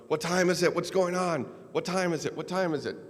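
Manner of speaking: anxious repeated questions from a head-injured man; confused injured gunner. Retold or imitated.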